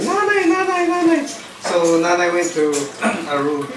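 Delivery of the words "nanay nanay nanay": mock scream. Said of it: "she was screaming".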